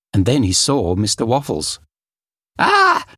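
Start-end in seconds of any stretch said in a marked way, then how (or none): 2.6-3.1 high, loud cry of fright; startled yell. Acted. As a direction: yelp in shock at the stranger. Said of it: "he yelled".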